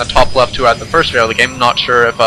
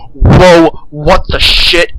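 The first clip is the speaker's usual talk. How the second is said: loud "whoa", then a drawn-out exclamation; hammed-up shock, loud then slowing down; mock startled reaction; 3 semitones higher, 5 dB louder, much slower than usual. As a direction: play a player stunned by what they see. Acts out a startled Bronze player.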